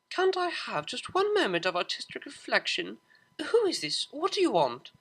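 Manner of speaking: high, loud irritated demand; annoyed phone answer. Acted. Interrupted in a tender moment, snapping at the caller. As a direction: snap at the unknown caller.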